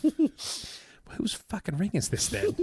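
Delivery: high, drawn-out comic disbelief; mock exasperation. Magician to lady who disowns the ring, mock exasperated. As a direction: squeal it in pretend bafflement, slowly.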